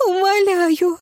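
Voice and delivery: high, loud anguished plea; moaning plea. A narrator's reading. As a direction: moan it out in despair. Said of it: "простонала она".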